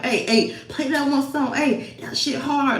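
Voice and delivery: a dad in the car asking for a song, lightly voiced excitement; hyped dad.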